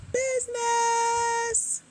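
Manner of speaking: high, very slow held word; drawn-out flourish. Real, not acted. Host welcoming scholarship winners to the grant game, high drawn-out flourish. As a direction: stretch it out way up high.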